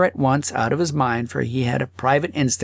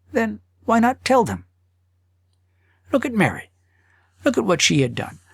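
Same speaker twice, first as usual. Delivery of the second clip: higher, self-justifying husband voice; inner voice; 7 semitones higher than usual. Acted. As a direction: argue with yourself, rationalizing.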